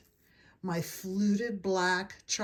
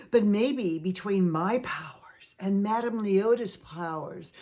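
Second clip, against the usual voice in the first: whisper; 4 dB louder than usual. Acted. Conjuring spirits.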